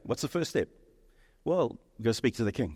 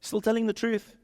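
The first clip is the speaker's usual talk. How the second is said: ordinary delivery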